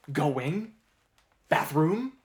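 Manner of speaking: "Going" as go-WING, "Bathroom" as bath-ROOM, rising; wrong-syllable stress. Shown as a demonstration. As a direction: query "Going" and "Bathroom" with the last syllable punched upward. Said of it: "place the stress of that lyric on two and four".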